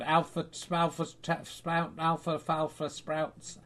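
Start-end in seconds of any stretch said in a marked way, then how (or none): none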